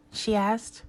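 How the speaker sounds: plain reading voice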